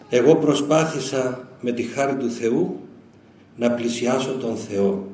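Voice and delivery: slow, grave reading of the elder's words; solemn quote. Retold or imitated. The preacher reads the elder's testament slowly and solemnly. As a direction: read it slowly, with weight. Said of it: "λέει ο γέροντας".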